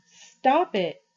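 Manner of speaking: anguished stop it, loud and high; gasped through clenched teeth. A narrator's reading. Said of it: "Johnny gasped from between clenched teeth".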